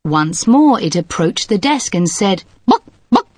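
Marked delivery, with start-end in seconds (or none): 2.7-3.3 high clucking chicken voice; a chicken saying bok bok. Acted. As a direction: cluck it like a hen at the desk. Chicken returns to desk.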